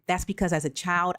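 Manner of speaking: ordinary talk, nothing marked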